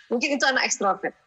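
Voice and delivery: ordinary talk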